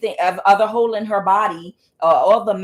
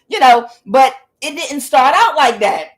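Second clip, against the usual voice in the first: ordinary talk, nothing marked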